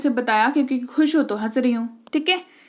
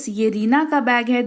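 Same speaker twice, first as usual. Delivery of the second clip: usual speaking voice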